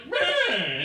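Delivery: high, loud drawn "Man"; exasperated man. Retold or imitated. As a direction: let it out in disbelief. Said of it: "He was just like".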